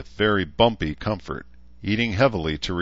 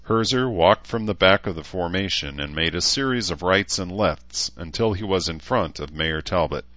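usual speaking voice

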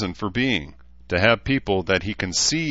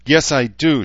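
taut; 7 semitones higher, 8 dB louder than usual. A narrator's reading. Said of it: "she said tautly".

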